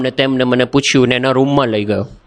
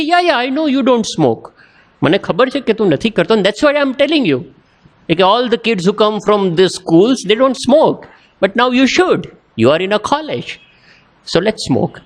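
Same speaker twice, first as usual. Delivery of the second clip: lightly acted high-pitched insistence; coaxing senior; 8 semitones higher, much slower than usual. Senior persuading a newcomer to smoke, mock insistent coaxing. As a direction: imitate a senior waving objections away, pitched up.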